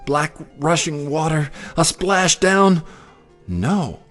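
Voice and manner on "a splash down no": ordinary delivery, nothing marked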